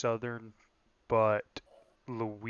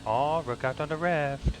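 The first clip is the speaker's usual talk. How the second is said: put-on higher-pitched caricature Korean accent; mock Korean accent; 4 semitones higher, 4 dB louder than usual. Korean spotter warning the driver, mock Korean accent. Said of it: "little Korean guy sitting next to you".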